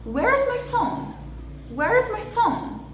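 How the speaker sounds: Where's high and drawn out, phone strong, my squeezed small; slow demo question with high, stressed Where's and a reduced my. Shown as a demonstration. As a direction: punch Where's and phone, shrink the "is" and my. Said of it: "Eu evidenciei where e phone".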